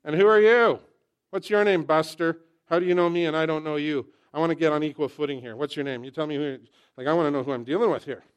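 loud, pushy mock challenge; confrontational demand. Jacob demanding the stranger's name, loud mock confrontation. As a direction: fire questions loudly at a stranger.

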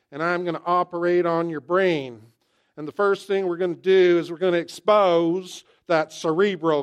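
hammed-up Deep South surgeon, big and loud; Deep South accent. Comedian's Deep South surgeon addressing a brain patient, loud Southern accent. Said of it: "take somebody from the deep south".

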